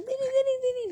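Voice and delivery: put-on high, sing-song coaxing to a baby; squeaky baby-talk coaxing. Mother coaxing her baby.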